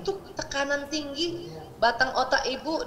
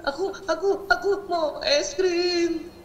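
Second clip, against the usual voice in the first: put-on higher, slow, drawn-out little child voice with a laugh; whiny child; 6 semitones higher, 3 dB louder, much slower than usual. Four-year-old begging mother for ice cream, whining. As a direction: play the sniffly child begging.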